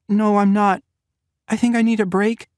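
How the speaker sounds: refuse, at the end of her strength; exhausted young woman near her limit; Natalia, young woman. A narrator's reading. Natalia asking to stop.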